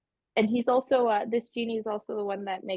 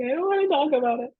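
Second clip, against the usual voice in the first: crying voice; 6 semitones higher, 4 dB louder, faster than usual. Acted. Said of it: "And I'm like".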